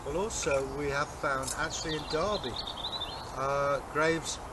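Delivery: ordinary talk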